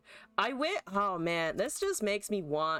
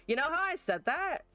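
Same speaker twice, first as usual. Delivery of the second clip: high, questioning build-up; rising exasperation; 10 semitones higher than usual. Real, not acted. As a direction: ask it with pitch climbing. Building up to an outburst.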